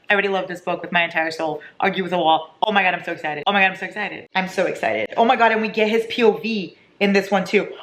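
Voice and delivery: usual speaking voice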